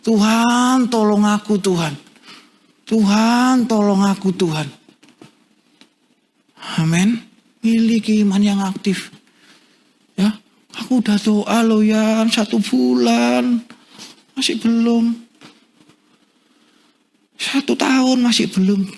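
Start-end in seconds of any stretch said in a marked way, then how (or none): 0.0-4.7 raised, drawn-out call that falls away; crying to God. Shown as a demonstration. Preacher showing how to shout to God for help, crying out. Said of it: "teriak sama Tuhan". 10.8-19.0 weary complaint. Acted. Preacher voicing a believer who has prayed a month unanswered, complaining.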